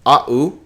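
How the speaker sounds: "a u" split into "ah" and "oo"; two separate vowels. Shown as a demonstration. Teacher shows a diphthong read wrongly, vowels split. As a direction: break "a u" into two sounds, ah-oo. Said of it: "because this is oi, au, u".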